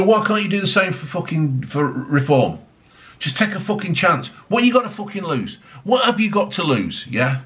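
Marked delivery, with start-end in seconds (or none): none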